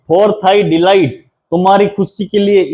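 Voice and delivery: ordinary talk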